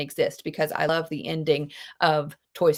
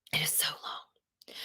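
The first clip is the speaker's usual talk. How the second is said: softly whispered aside; 12 dB quieter than usual. Real, not acted. Teacher whispering aside to students. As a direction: whisper it as a secret.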